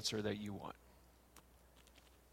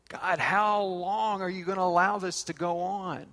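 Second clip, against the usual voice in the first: raised, pleading lament; psalmist complaining; 5 semitones higher, 5 dB louder than usual. Retold or imitated. The psalmist complaining to God, a raised lament. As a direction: cry out to God in frustration. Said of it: "The psalmist complains".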